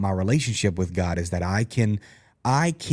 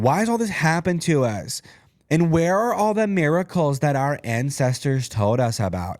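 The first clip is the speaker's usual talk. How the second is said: a hint of a higher, complaining Gideon; whiny Gideon; 5 semitones higher than usual. Host reading Gideon's complaint to the angel, mildly whiny. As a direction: lightly whine the questions. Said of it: "I don't know if that's how he sounds".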